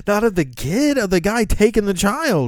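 loud and high, frantic; panicked arguing. Acted. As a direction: argue in a panic. Panicked handler argues with sniper.

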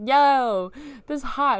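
high, loud acted whoop; excited approval. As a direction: exclaim it, thrilled and loud. Dream: reacting to the men.

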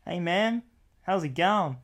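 lichte Amerikaanse tongval; American accent. Acted. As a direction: vriendelijke Amerikaan stopt. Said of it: "That's American".

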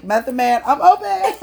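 high, laughing mock flirty offer; mock come-on. Fantasizing about [name], high laughing come-on. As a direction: call out to him playfully. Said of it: "I'm like".

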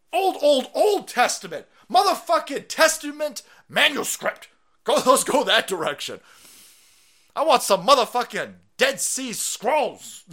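loud, high, emphatic rallying cry; hyped-up demand. Real, not acted. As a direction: belt out each phrase like a rallying call. Hyped demand for Old Testament.

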